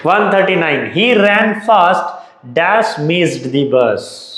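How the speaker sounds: reads the fill-in question very slowly; drawn-out quiz dictation; slow question reading. Shown as a demonstration. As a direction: spell out each word for the class.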